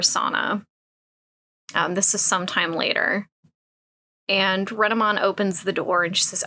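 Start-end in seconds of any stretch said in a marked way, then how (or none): none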